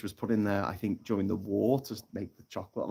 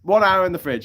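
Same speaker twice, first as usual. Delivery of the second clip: loud, raised barked order; sergeant major; 8 semitones higher, 8 dB louder than usual. Acted. Sergeant major punishing the parrot, loud barked order. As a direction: bark out the punishment. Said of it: "So the sergeant major goes".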